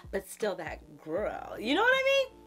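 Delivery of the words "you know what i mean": excited. Real, not acted. Hyping the fragrance.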